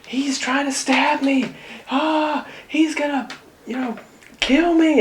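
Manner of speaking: high, frantic cries for help; a woman yelling that she is about to be stabbed; panicked yelling. Retold or imitated. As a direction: scream like your life is in danger. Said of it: "outside yelling".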